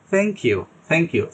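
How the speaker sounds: slow, soft thank you for the class. Shown as a demonstration. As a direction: thank you with the tongue tip lightly touching the front teeth. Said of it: "হালকা করে touch করে".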